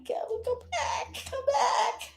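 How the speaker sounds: high, laughing-crying plea; pleading. Real, not acted. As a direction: beg them to come back, half laughing. Begs the cameras to come back.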